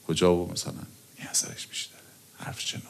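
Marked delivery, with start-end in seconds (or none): 1.2-2.8 whisper. Real, not acted. Confiding point to audience.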